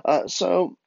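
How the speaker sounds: usual speaking voice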